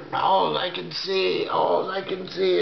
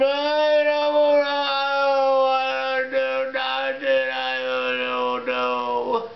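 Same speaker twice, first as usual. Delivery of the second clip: fearful denial; 6 semitones higher, much slower than usual. Retold or imitated. Denies, drawn out and shaky.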